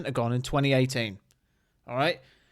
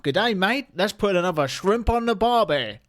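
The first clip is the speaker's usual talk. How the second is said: jokey mock-Australian accent, pitched up; uncertain put-on accent; 4 semitones higher than usual. Vlogger joking over the NASA live stream, mock Australian accent. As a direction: have a playful, wobbly go at an Aussie accent. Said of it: "Don't know what accent that was".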